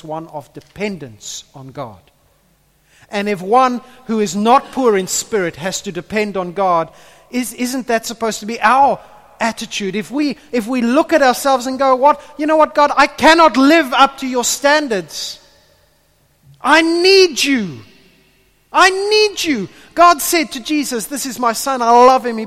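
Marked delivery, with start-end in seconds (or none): 3.1-15.4 loud, high, driving sermon voice; preaching at full force. Acted. Preacher urging the church to depend on God, full force. 16.6-17.8 slow, drawn-out plea, loud and high; pleading. Acted. Believer admitting need to God, slow loud plea. 18.7-19.7 higher, louder plea, quicker than the first; pleading. Acted. Believer repeating need to God, higher loud plea.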